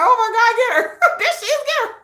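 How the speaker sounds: high, laughing mob cheer; giggling hype. Pretend. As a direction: egg on the mob through laughter. Fans spotting the target.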